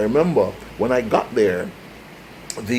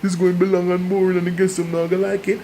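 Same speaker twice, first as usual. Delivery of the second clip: higher, fast, a burst of anxious protest; worried staff objection; 7 semitones higher, 4 dB louder, faster than usual. Retold or imitated. Playmakers warning their boss the lesson will be long, in hurried, higher protest. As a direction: blurt it out like a nervous employee warning the boss. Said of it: "my playmakers were like".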